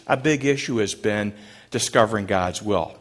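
usual speaking voice

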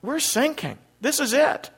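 high-pitched despairing cry, not shouted; acted panic in a high voice; frantic, stressed. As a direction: act out a sailor giving up in alarm. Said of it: "they're frantic and they're stressed".

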